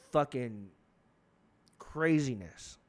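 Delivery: ordinary talk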